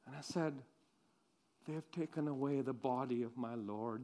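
soft, low, dejected voice; barely above a breath, lost in grief; grieving hush. Acted. Mary alone at the tomb, soft and grieving.

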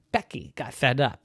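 ordinary delivery

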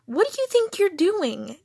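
high, loud outraged demand; indignant exclamation. A narrator's reading. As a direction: confront them in exasperation. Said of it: "M exclaimed".

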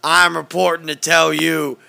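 loud ranting radio-host voice; Alex Jones impression. Doing Alex Jones for a friend, loud radio ranting. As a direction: announce it like breaking conspiracy news. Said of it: "Was that a good Alex or not".

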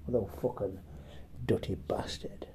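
soft, whispered insult; muttering under breath. Real, not acted.

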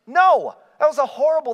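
loud, high NO to the church; emphatic no. Real, not acted. Preacher rejecting Abram's decision, emphatic loud no. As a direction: shut it down hard.

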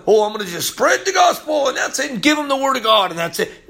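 high-pitched, pushy parody of an evangelist; mock zealous. Preacher mimicking people who just spread the gospel, mock zealous. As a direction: crank the pitch up and mock their eagerness.

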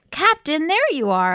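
high, loud call; shouting. A narrator's reading. Detective hails the captain at the contest, high acted shout. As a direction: call out on spotting a friend. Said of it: "I shouted".

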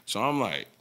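normal talking voice

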